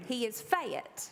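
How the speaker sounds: slow Eastern Kentucky "He is Fayette", fat in two syllables; Eastern Kentucky accent. Shown as a demonstration. Using the word in a sentence for the congregation, Eastern Kentucky accent. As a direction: drawl "Fayette" out Kentucky style. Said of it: "was how I said fat".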